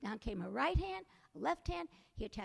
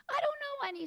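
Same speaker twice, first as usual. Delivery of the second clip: put-on much higher, louder boyish protest; Jack's voice; 13 semitones higher, 5 dB louder, faster than usual. A narrator's reading. Jack protests to the skeleton, high and loud. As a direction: squeak it out. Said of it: "said Jack".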